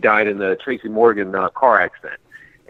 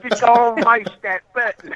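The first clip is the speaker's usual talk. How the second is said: Southern lady; 11 semitones higher than usual. Retold or imitated. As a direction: imitate a North Carolina woman, pitched high. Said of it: "this lady was like".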